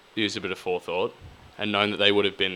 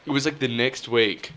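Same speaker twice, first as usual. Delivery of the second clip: ordinary talk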